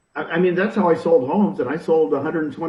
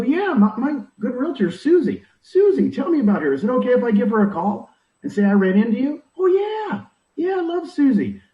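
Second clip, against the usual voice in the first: mock enthusiastic stranger; 7 semitones higher than usual. Acted reply of a stranger.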